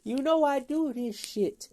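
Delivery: laughing at his freaked-out passenger. Retold or imitated. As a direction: tell him to relax while cracking up. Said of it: "I'm like".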